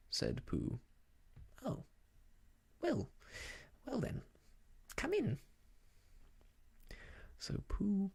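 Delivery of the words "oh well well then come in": Rabbit voice. Acted. Rabbit gives in.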